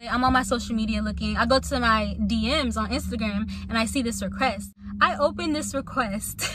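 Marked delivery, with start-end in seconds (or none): none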